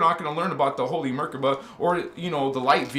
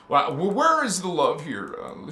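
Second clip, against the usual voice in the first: ordinary talk, nothing marked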